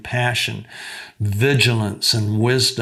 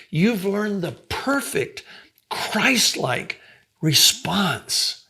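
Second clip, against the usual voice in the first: pitched well higher, eager and marvelling; excited, higher teacher's wonder; amazed praise; 6 semitones higher than usual. Retold or imitated. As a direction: lay out how astonishing her answer is. Counselor amazed at her answer.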